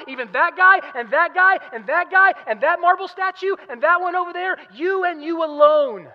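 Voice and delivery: loud, high, emphatic proclamation; full-force preaching. Acted. Preacher voicing Peter's confession among false gods, full-force preaching. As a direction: hammer each phrase out to the congregation.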